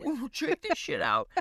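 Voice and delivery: high, hushed mock squeal of glee; excited gushing. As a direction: tease a friend with juicy gossip. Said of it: "I'm like".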